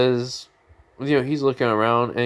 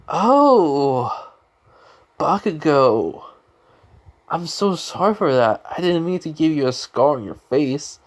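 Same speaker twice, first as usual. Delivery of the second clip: exaggerated apology; 5 semitones higher, 3 dB louder than usual. Boy apologizing for a scar, hammed-up apology. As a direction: lay the apology on thick, raised pitch.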